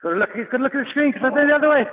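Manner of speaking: high, fast laughing call. Real, not acted. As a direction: laugh while calling out. Coach laughing mid-drill.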